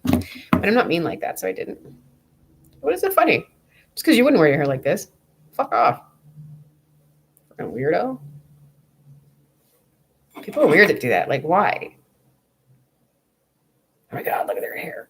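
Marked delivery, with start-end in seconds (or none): 2.8-3.5 high, sharp indignant challenge; pitched-up exasperated retort; indignant demand. Real, not acted. Retorting to laughing guy. 14.1-15.1 whispered catty gossip; mocking whispered gawking.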